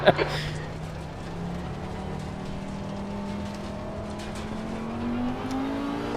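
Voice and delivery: long, soft, high real laugh; drawn-out quiet giggling fit. Real, not acted. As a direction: crack up and keep laughing. Laughs at co-driver's warning.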